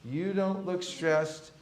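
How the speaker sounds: slow, deliberate delivery; slow demo. Shown as a demonstration. Teacher modelling the line to the class, slow. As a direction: lay each word out slowly. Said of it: "All right".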